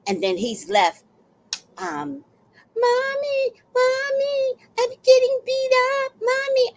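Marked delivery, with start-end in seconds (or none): 2.8-6.7 put-on very high childish wail for mommy; whiny little boy. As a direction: cry for mommy like a beaten kid. Voicing crying abused boy.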